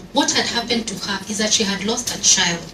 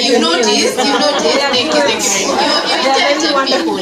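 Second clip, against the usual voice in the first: teasing the crowd, fast and bright at the end; playful teasing; 4 semitones higher, 5 dB louder than usual. Real, not acted. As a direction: playfully flatter the audience about her nails. Teasing audience about her nails.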